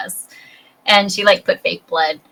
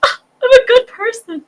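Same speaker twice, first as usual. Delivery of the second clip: much higher, hammed-up self-satisfied sigh; mock smug sigh. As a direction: pat yourself on the back, playfully.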